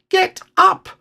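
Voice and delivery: very high, loud squeaked order; tiny, insistent high voice. A narrator's reading. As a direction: squeak urgently from underneath someone. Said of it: "squeaked Piglet".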